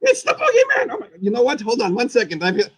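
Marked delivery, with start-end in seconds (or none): 0.0-1.1 very high, squeaky announcement; high puppet voice. Acted. Puppet Boogeyman introducing himself, very high squeaky voice. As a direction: pipe it up like a little creature.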